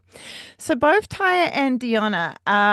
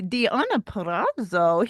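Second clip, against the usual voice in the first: ordinary talk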